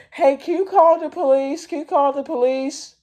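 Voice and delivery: very high-pitched urgent plea; mock panicky woman. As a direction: imitate a lady pleading for help, squeaky high. Retold lady begging for police.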